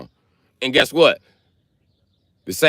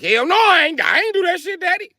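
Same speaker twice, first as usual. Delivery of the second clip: very high, whiny protest; hammed-up panicked son pleading innocence; Jesus as scared son. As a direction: squeak out a denial to daddy. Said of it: "He like".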